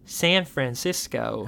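drawn-out American accent; American accent, every syllable stretched. Shown as a demonstration. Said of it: "I hope you enjoy my American accent there".

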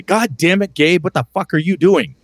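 imitating an exasperated party member; mock angry rant. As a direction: play the fed-up friend, pitch up. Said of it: "look at you and say".

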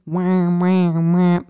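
wailing siren noise made by voice; imitated ambulance siren. Storyteller mimics the ambulance alarm arriving, imitated siren wail. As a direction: wah out a siren. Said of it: "don't know the word for this".

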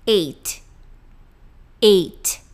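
"eight" cut off on a crisp t; ending in t. Shown as a demonstration. Teacher showing the number differs from H, crisp t. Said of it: "ele se pronuncia".

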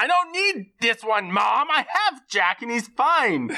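sulky hammed-up refusal; overacted man-child arguing with mother; mock whiny protest. [name] arguing with mom.

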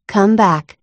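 ordinary delivery, nothing marked

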